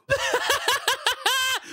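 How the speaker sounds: cackling at the prank; high gleeful laugh. Real, not acted. Floki fell down.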